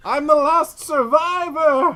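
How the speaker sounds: very high, loud, drawn-out wail; hammed-up, stretched-out shriek; mock survivor's scream. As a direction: scream it out from a burning castle. Survivor screams from the burning castle.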